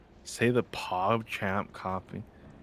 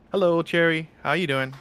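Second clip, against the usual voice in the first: higher, louder bright hello to a friend; excited greeting; 8 semitones higher, 7 dB louder than usual. Real, not acted. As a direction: call out a cheery hello.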